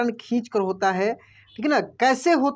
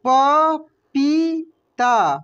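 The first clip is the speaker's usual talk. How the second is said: पपीता drawn out very slowly, each syllable separate; 4 semitones higher, 5 dB louder, much slower than usual. Shown as a demonstration. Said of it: "क्या पढ़ेंगे हम लोग?". (पपीता को धीरे धीरे बोलिए)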